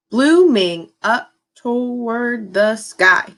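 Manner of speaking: Blooming up toward the sky, slow and beat by beat; clapped out slowly. Shown as a demonstration. Teacher clapping out the haiku line with the class. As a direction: read it slowly, clapping each syllable. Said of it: "let's clap it out together".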